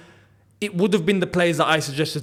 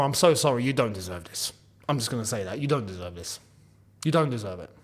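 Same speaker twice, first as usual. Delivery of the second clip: soft, low consoling words, much softer; sympathetic apology; 9 semitones lower, 8 dB quieter than usual. Real, not acted. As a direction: apologise gently to the left-out player. Consoling Cole Palmer directly.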